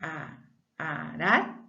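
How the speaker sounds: slow teacher demo of a weak R; stretched weak R. Shown as a demonstration. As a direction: sound out "arar" gently with a light tapped r. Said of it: "Eu leio arar".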